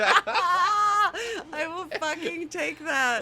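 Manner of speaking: laughing. Real, not acted. Pleased laughing reply.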